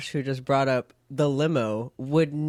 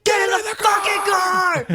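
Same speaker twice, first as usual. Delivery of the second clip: high furious yell; angry shout; 7 dB louder than usual. Retold or imitated. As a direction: scream it at them, fed up. Acting out hero yelling louder.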